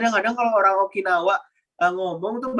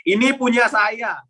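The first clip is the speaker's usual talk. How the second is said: "ini punya saya" raised in pitch, falling at the end; higher-pitched, emphatic "ini punya saya"; different intonation; 6 semitones higher, 4 dB louder, slower than usual. Shown as a demonstration. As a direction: claim it firmly: high "ini punya saya", dropping on "saya". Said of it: "tiga kata ini dengan intonasi yang berbeda".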